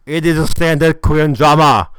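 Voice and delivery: loud, hammered-home verdict; emphatic declaration. Real, not acted. Reviewer sums up the show's formula, loudly emphatic. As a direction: announce it loudly, falling at the end. Emphatic punchline, then repeated.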